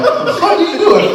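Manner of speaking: high-pitched incredulous outburst; mock incredulous. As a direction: act out disbelief, voice jumping way up. Retold shocked reaction to friend.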